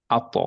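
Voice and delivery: আত with an echoing bounce on ত; with qalqalah. Shown as a demonstration. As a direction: let the ত in আত spring back. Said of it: "এরকম পড়ব".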